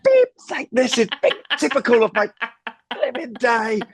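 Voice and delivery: hammed-up, very high-pitched comic meltdown; end-of-the-world rant. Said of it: "spill their tea and it would be like the end of the world".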